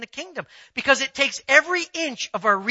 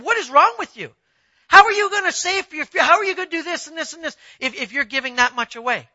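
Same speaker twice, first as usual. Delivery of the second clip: higher, louder and faster disbelief; mock outraged accountant; incredulous accountant; 5 semitones higher, 4 dB louder than usual. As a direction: scold him like he's lost his mind. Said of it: "That's what he said".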